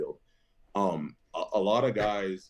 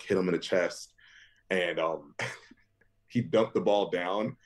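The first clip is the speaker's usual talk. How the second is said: normal talking voice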